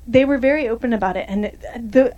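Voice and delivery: ordinary delivery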